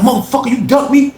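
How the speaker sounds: retold angry tirade; betrayed rage. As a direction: tear into him for the betrayal. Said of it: "Slim was like".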